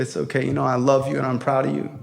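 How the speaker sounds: low, soft father's comfort; quiet reassurance. Retold or imitated. Father forgives son kicked out of school, quiet reassurance. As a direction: settle down and reassure him softly.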